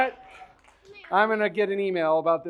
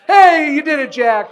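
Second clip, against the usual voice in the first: excited cheer; 5 semitones higher, 12 dB louder than usual. Real, not acted. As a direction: cheer him on loudly. Kid finishes task on stage.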